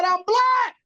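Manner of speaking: high, heated retold clapback; indignant retort. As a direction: fire it back, insulted. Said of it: "I said".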